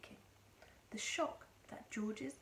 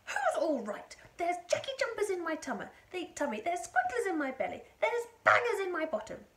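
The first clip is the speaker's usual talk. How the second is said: high, loud, indignant tirade; yelling; 9 semitones higher, 9 dB louder than usual. A narrator's reading. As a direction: rattle off the complaints at a shout. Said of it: "she yelled".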